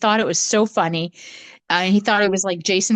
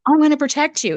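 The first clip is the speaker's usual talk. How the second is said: mom protective; 6 semitones higher, faster than usual. Retold or imitated. Mom vowing to protect girls.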